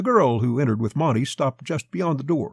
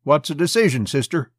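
higher-pitched, exasperated demand; 4 semitones higher, 4 dB louder than usual. A narrator's reading. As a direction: press for an answer with exasperation. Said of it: "exasperation in his voice".

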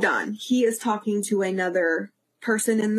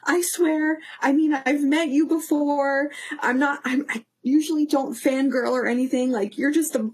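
higher-pitched, imitating her own embarrassed excuses; flustered protesting; 5 semitones higher than usual. Tearful fan assuring celebrity she's fine, flustered protesting. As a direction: insist you're fine, pitched up.

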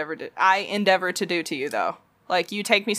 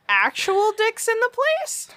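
much higher and slow, disbelieving; high incredulous voice; 12 semitones higher, slower than usual. Real, not acted. Teasing incredulous question.

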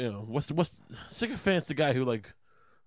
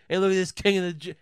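higher, louder heckle; mocking jeer; 7 semitones higher, 5 dB louder than usual. Crowd mocking the man on the cross, jeering. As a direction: sneer the title at him. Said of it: "they're mocking this guy".

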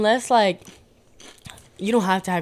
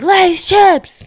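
high, very loud yell; scream; 14 semitones higher, 14 dB louder than usual. Shown as a demonstration. Guest shows she would rather scream, screamed. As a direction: scream it out at full volume. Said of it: "I'd rather scream".

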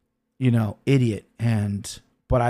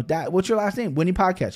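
put-on higher, innocent little kid voice; little girl voice; 7 semitones higher than usual. Four-year-old daughter asking dad their last name, higher childlike voice.

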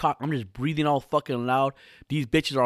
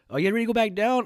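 much higher, lightly voiced impatient girls; mock girl voice; 11 semitones higher, 3 dB louder, faster than usual. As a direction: play the waiting hikers eager to leave. Girls asking him to leave.

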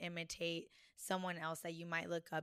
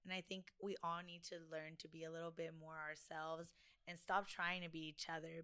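normal talking voice

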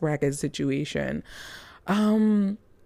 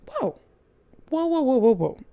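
stunned whoa; 8 semitones higher than usual. Retold or imitated. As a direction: relive being blown away by the movie. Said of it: "I was just like".

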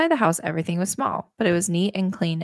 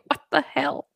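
incredulous; 9 semitones higher than usual. Retold or imitated. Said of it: "I'm just like".

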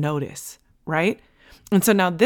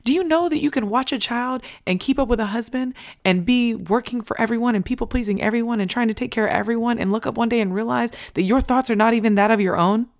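normal talking voice

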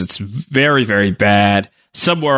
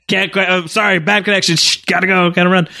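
high, hurried fake phone excuse; fake bad line; 6 semitones higher, faster than usual. Palpatine hanging up on Separatists, hurried fake-static excuse. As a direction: dodge the call pretending static.

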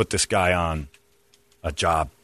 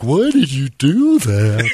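imitating friend; 4 semitones higher, 5 dB louder, much slower than usual. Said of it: "And Chuck's like".

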